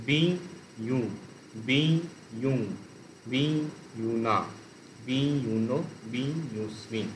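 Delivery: each বি set apart from its ইউ ending; split into syllables. Shown as a demonstration. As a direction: recite বি, brief break, then each ইউ form. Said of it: "বিং একখানা syllable, ইউ একটা syllable". (বি আলাদা রেখে ধীরে ধীরে রূপ বলা)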